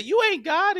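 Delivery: very high, loud mock girl's voice; imitating a girl's indignant challenge. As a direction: pipe up loudly in a high voice. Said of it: "a girl said".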